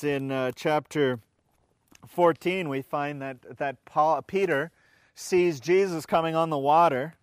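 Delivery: usual speaking voice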